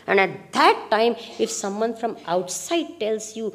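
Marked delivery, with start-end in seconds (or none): none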